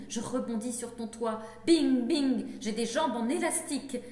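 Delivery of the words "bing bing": two ringing bings, each falling in pitch; loud imitated bing. Poet falling off the shelf, loud imitated impacts. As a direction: punch out the bings like bumps.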